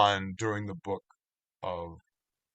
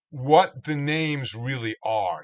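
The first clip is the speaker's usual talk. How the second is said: normal talking voice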